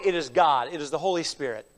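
normal talking voice

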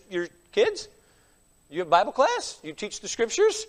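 ordinary delivery

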